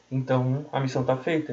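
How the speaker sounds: ordinary talk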